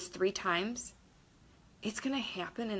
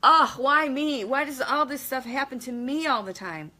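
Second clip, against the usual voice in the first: mock whining; 4 semitones higher, 6 dB louder than usual. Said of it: "And then you're like".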